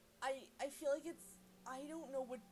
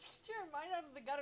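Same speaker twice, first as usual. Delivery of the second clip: normal talking voice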